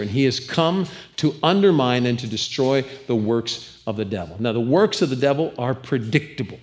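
ordinary delivery

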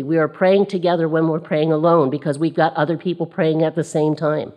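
normal talking voice

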